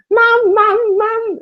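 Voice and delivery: very high, loud mocking call; taunting mum call. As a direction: squeal it to tease your son. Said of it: "as a way of taunting me".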